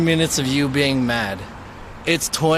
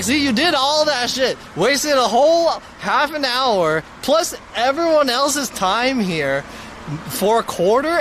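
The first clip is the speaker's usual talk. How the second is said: higher and slower, rubbing it in; mock lecture to the lady; scolding told-you-so; 9 semitones higher than usual. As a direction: drag it out to scold her. Telling off the lady as she leaves.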